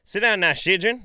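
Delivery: stern teacher. Retold or imitated. Teacher ordering the class to sit, loud.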